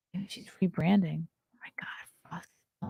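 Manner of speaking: breathy unvoiced whisper. Real, not acted. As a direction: whisper it to yourself. Whispering while searching.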